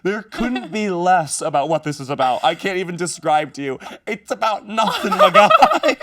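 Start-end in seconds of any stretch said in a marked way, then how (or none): 4.1-6.0 high squealing laugh through words. Real, not acted.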